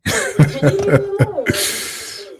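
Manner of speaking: sudden high burst of laughter at a grim clip; can't help cracking up, then catch yourself; real laugh. Real, not acted. Streamer bursts out laughing at the grim video clip, a high laugh. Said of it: "Look, I shouldn't even laugh".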